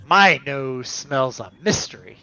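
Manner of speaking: usual speaking voice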